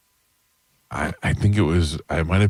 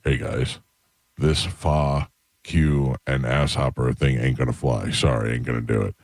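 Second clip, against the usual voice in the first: John Wells; 5 semitones lower than usual. Retold or imitated. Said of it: "he was like".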